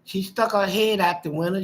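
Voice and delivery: ordinary delivery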